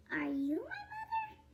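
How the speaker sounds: high, small chick voice; baby bird. Acted. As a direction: squeak the question up at the dog. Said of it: "he said to the dog".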